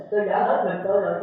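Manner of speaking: normal talking voice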